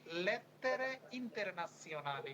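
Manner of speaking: লেত্তেরে ইন্টারন্যাশনালি said slowly, with a held double t; teacher spells out লেত্তেরে ইন্টারন্যাশনালি slowly and clearly; careful Italian term. Shown as a demonstration. Teacher models the Italian term for students to repeat. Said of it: "বলেন তো দেখি কি বলছে".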